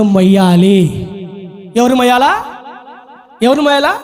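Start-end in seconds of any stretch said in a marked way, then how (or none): none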